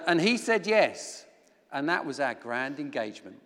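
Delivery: ordinary delivery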